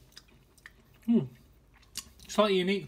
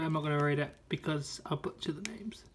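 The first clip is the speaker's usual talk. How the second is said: whispering; 7 dB quieter than usual. Real, not acted. Quick aside about the story.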